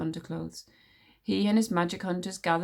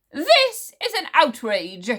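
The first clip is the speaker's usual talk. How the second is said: much louder, slow indignant outburst; outraged; 8 semitones higher, 8 dB louder, slower than usual. A narrator's reading. Witch smeller outraged at his dismissal, loud. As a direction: blurt out fury, trembling. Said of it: "he said".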